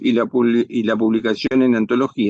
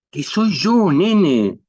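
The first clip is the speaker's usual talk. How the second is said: higher, impatient repeat; exasperada; 6 semitones higher, much slower than usual. Acted. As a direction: insist through embarrassment. Repite exasperada su confesión. (exasperada, tono agudo)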